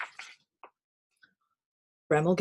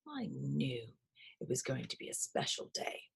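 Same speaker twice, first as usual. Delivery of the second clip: soft, lower, unvoiced whisper; whispered to himself; 5 semitones lower, 13 dB quieter than usual. A narrator's reading. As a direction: murmur it contentedly under the breath. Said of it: "said Bramble Brown to himself".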